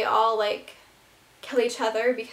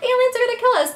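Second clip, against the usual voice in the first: panicked; 11 semitones higher, 5 dB louder, faster than usual. Retold or imitated. People panicking about aliens.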